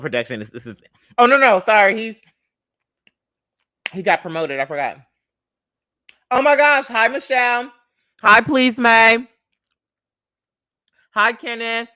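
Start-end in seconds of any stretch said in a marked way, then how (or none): 6.3-11.9 loud, high welcome, stretched out slowly; excited greeting. Real, not acted. Host welcoming viewers to the stream, loud squealed greetings. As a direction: squeal hello to each viewer.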